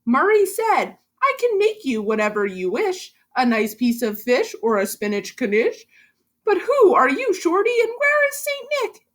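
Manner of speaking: put-on lively rhyming read-aloud for kids; story narration. A narrator's reading. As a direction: read it like a bouncy bedtime rhyme. Teacher reads picture book aloud.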